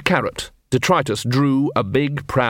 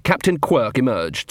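usual speaking voice

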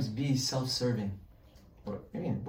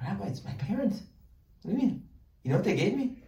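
much higher, incredulous and earnest; earnest congregant; 8 semitones higher, faster than usual. Retold or imitated. Son answering the rabbi about parents, higher and incredulous. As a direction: act out the son who finds the question absurd.

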